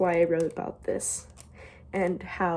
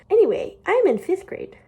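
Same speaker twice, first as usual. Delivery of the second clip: put-on bright, higher reading of old entry; embarrassed diary reading; 9 semitones higher, 5 dB louder than usual. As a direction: read it like a chirpy kid. Reading childhood diary aloud.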